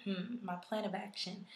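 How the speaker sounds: ordinary talk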